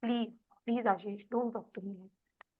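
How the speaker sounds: calm him down without raising your voice; quiet, patient plea; calm soft tone. Shown as a demonstration. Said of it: "पहले तो अपनी tone पे काम करो".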